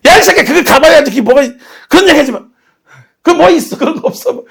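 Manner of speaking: high-pitched scornful dismissal; hammed-up cranky grandmother; mock scolding. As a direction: play an elder angrily brushing off the preaching.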